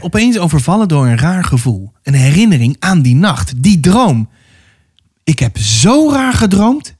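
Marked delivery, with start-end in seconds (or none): 5.2-6.9 high, drawn-out exclamation; exclaiming. A narrator's reading. Girl suddenly tells her friend about a dream, exclaiming. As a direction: burst out with it, pitch lifted, without shouting. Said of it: "riep ze uit".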